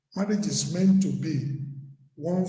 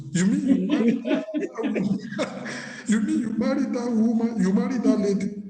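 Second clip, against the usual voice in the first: mock shock; 3 semitones higher, faster than usual. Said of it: "You are in soup".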